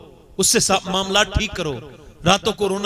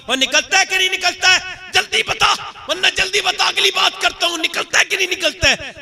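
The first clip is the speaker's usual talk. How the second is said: high, pressing call; urgent demand; 11 semitones higher than usual. Acted. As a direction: push him to come out now. Moses demands a third time. (ऊँची, ज़ोरदार माँग)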